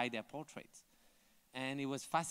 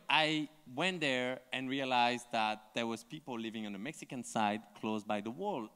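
normal talking voice

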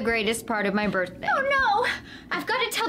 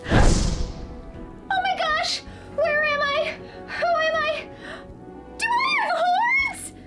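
panicked shock; 10 semitones higher, slower than usual. Acted. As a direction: shriek in horror at yourself. Elsa finds herself transformed.

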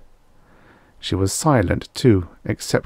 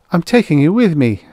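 high, loud, insistent declaration; firm; 9 semitones higher, 7 dB louder than usual. A narrator's reading. Ida to the man who seized her arm, firm. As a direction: insist firmly in a raised, higher voice. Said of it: "Ida said firmly".

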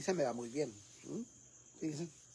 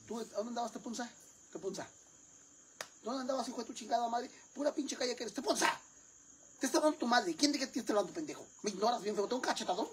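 high-pitched scolding mother voice, furious at her son; 7 semitones higher, 4 dB quieter than usual. Acted. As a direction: yell at the missing son like an enraged mom.

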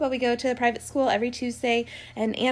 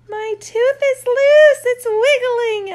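put-on much higher, louder and slower child voice; imitating her son; 13 semitones higher, 11 dB louder, slower than usual. Mom imitating her son announcing his loose tooth, high. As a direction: squeal it like an excited little boy. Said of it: "And he goes".

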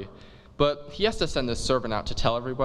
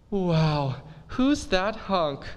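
slow, higher swooning wow; smitten girl; 6 semitones higher, much slower than usual. Acted. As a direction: swoon over the hunk. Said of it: "And she goes".

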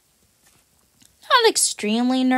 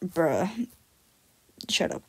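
grumpy; 3 semitones lower, 8 dB quieter than usual. Acted. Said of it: "he's just kinda grumpy".

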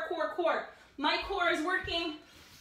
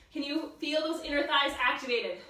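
ordinary talk, nothing marked

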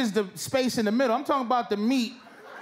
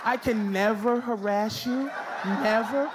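usual speaking voice

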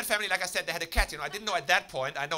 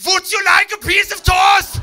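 "Would you like a piece of toast?" shouted much higher and louder, slow, in a harsh mock German accent; mock German bark; 16 dB louder, slower than usual. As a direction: bark "toast" like a caricature German officer, slowly and very loud.

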